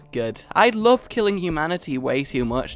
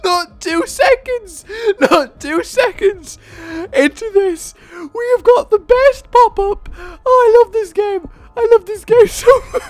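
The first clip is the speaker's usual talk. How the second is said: high squealing delight, much louder; ecstatic shriek; 7 dB louder than usual. Real, not acted. Gamer shrieks at a lucky pop-up, ecstatic squeal.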